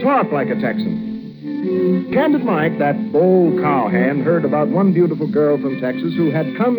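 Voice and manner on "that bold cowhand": normal talking voice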